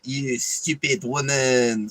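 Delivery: slow, hammed-up mock French accent, mocking the French. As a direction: drag it out in a cartoon French accent. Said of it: "taking the piss out of how the French speak".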